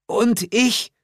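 high, eager woman's voice; Glenda asking. Acted. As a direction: Glenda asks what she gets to do. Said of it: "fragte Glenda".

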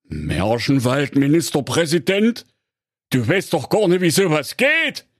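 rising, disbelieving husband voice; astonished. A narrator's reading. Husband stunned by his wife's wish, astonished rising question. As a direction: echo her word in amazement. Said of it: "rief ... erstaunt".

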